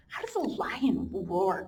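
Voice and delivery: usual speaking voice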